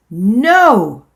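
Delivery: sharp, loud no; loud refusal. Acted. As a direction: slam the door on it. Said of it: "I said".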